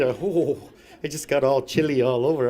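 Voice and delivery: normal talking voice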